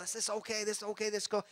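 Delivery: high, sing-song mock voice talking yourself into it; mocking self-talk. Mocking people talking themselves into it.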